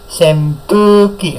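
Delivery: Senpuki said as sempuki, with m before the p; sem not sen. Shown as a demonstration. Teacher showing students that written sen is read sem. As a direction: slowly sound Senpuki with an m: sem-pu-ki. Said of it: "Bacanya sem".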